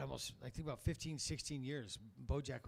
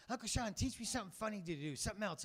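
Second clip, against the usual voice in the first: put-on higher pleading child voice; kid voice; 7 semitones higher than usual. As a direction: ask eagerly like a small boy, higher. Nephew voiced in story.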